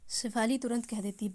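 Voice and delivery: plain reading voice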